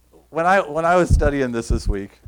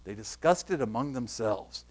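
hushed reading; 6 semitones lower, 9 dB quieter than usual. Shown as a demonstration. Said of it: "I love the commentator".

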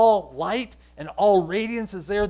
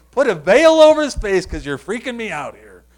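much louder, alarmed outburst; freaked-out shout; 2 semitones higher, 13 dB louder than usual. Acted. Preacher acts out someone scared by a glowing face, loud and alarmed. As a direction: blurt it out loudly, freaked out. Acts out frightened onlooker's demand.

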